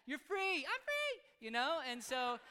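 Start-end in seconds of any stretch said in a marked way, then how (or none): 0.1-1.2 high-pitched, loud cheer; joyful shout. Retold or imitated. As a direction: shout it jubilantly, pitch leaping up. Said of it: "They're supposed to shout that".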